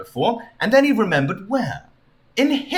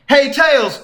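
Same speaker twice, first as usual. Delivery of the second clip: lightly voiced Sonic; 9 semitones higher, 5 dB louder than usual. Sonic calls Tails.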